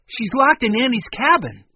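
high, loud urgent report; urgent alarm. A narrator's reading. Children telling a rescuer of the captive, urgent. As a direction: blurt out the emergency.

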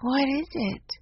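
high, sleepy murmur. Acted. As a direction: ask as if just woken. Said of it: "asked in a sleepy voice".

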